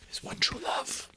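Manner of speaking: breathy whispered aside. Real, not acted. Narrator commenting on Scrooge losing his love, whispered. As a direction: hush it out sadly.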